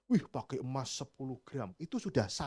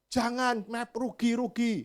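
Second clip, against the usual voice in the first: supplier protesting; 9 semitones higher, slower than usual. Retold or imitated. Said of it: "supplier answers".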